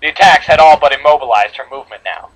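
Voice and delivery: ordinary delivery, nothing marked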